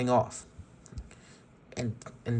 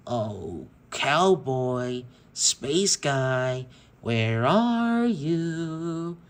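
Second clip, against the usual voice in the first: creepy tone; much slower than usual. Acted. Tex hunting the hiding toys off screen, creepy and slow. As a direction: croon it like a stalking killer. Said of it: "in a creepy tone".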